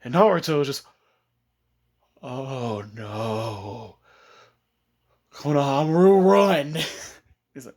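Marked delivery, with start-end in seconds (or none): 2.2-4.0 low, soft, ominous realization; drawn-out, sinking voice of doom; mock dread. Dread before the beating. 5.3-6.7 loud warning, rising to a yell; urgent warning. Retold or imitated. Warning the boy to flee.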